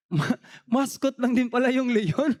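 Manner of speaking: ordinary delivery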